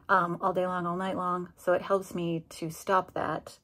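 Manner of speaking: usual speaking voice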